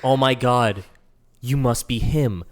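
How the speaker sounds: ordinary talk, nothing marked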